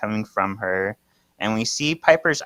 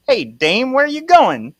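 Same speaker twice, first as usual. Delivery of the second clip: put-on high, loud old-fashioned fellow; [name]'s old-time voice; 13 semitones higher, 5 dB louder than usual. Calling after Kira as she walks away, hammed-up old-time voice. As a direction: put on a hammed-up man from another era. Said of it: "how did he talk?".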